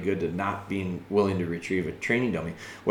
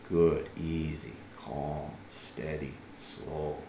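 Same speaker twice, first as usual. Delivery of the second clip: soft, low and slow soothing; calm, steady soothing; 7 semitones lower, 10 dB quieter, much slower than usual. Shown as a demonstration. Trainer calming the dog after taking the dummy, soft and slow. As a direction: talk the dog down gently and slowly. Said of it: "It means".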